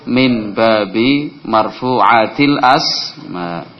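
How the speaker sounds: each syllable drawn out for the class; teacher modelling the Arabic sentence, very slow and clear; slow Arabic recital. Shown as a demonstration.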